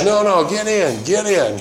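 high-pitched retold urging; imitated insistence. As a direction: imitate a friend who won't take no. Friend overrides his refusal.

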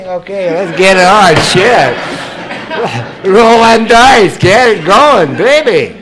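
loud, high, laughing whoops; rowdy partying. Acted. Drunk out on a Saturday night, rowdy and loud. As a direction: whoop it up, drawing out the words.

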